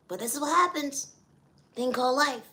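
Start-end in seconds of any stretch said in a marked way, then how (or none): none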